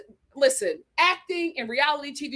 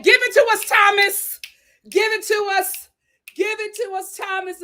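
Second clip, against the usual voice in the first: much higher and louder, shouting encouragement; excited cheering; 9 semitones higher, 6 dB louder than usual. Real, not acted. Host egging Thomas on to give the drama. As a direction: cheer him on like a fan.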